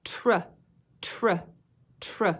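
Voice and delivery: tr sounded out on its own, three times; isolated tr blend. Shown as a demonstration. Teacher to class, sounding out the "tr" blend. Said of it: "Listen to the beginning blend".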